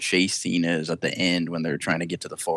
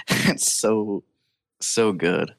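usual speaking voice

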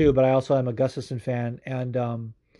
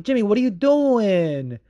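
high, loud, drawn-out dismay; exasperated plea; 8 semitones higher, 4 dB louder, much slower than usual. Retold or imitated. Fan to Jimmy about his comeback, exasperated. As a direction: wail it slowly in mock despair.

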